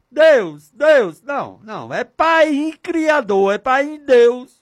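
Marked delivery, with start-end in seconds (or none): 0.1-1.2 sharp, high cries to God; loud calling. Shown as a demonstration. Demonstrates how to address God. 1.9-4.6 loud, high, stretched cry; fervent calling. Shown as a demonstration. Calls to God as Father.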